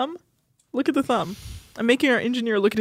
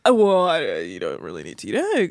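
lightly voiced nagging parents, lower; mock parents; 8 semitones lower than usual. Imitating parents going in on daughter, lightly mocked nagging. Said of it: "Like, they were like".

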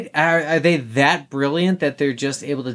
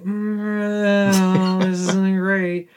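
long drawn-out, hesitant groan of disapproval; queasy doubt; 4 semitones higher, much slower than usual. Retold or imitated. Viewer reacting to killing Nazis, drawn-out queasy doubt. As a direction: stretch it out slowly, squirming with misgiving. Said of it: "I still was kinda like".

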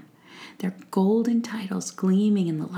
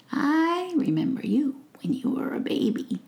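higher, whispery amused witch voice; fond old witch; 8 semitones higher than usual. Acted. Witch telling the prince she knew him as a baby, fond. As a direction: recall him fondly, like a doting old witch.

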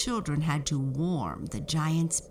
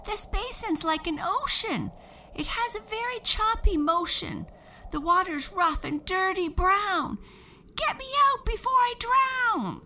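very high, louder squeaky voice; tiny boy voice; 4 dB louder than usual. A narrator's reading. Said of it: "he cried".

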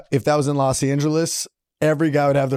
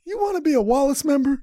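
high mock offer; 11 semitones higher than usual. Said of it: "And I was like".

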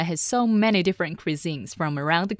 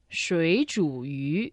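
slow, "水煮鱼" with each tone clear; slow model; slower than usual. Shown as a demonstration. Teacher joining the syllables for the learner, slowly. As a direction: put the three tones of "水煮鱼" back together slowly. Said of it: "So together".

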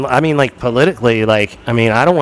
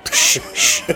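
sharp whispered hush; shushing; 3 dB quieter than usual. Pretend. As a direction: hush them urgently. Hushing during pretend raid.

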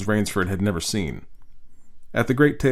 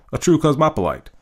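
ordinary delivery, nothing marked

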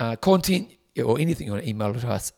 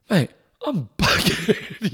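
laughing; 7 semitones higher, much slower than usual. Retold or imitated. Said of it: "he's like".

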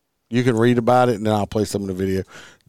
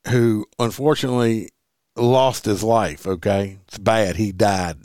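ordinary talk, nothing marked

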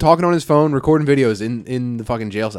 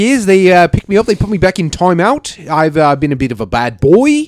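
higher, louder mock New Zealand voice; imitated New Zealand lad, pitched up; New Zealand accent; 6 semitones higher, 5 dB louder than usual. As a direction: play a cheeky New Zealand inmate reporting in. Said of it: "We got a New Zealand boy".

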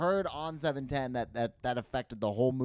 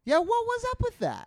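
incredulous; 6 dB louder than usual. Real, not acted. Asking about Davis.

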